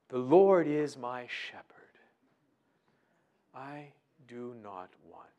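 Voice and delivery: slow, breathy whispered reading of the psalm; slow whispered scripture. Shown as a demonstration. Preacher demonstrating to listeners how to murmur scripture, slow whisper. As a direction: murmur each word slowly to yourself. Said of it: "Let your mouth be engaged with it".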